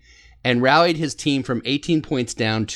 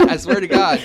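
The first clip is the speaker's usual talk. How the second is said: ordinary talk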